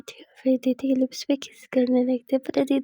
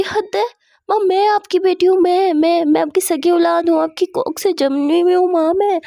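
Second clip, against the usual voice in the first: high, loud, wailing acted complaint; indignant protest; 5 semitones higher, 8 dB louder than usual. Daughter protesting that mother takes sides, indignant loud wail. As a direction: pile up the words in hurt outrage.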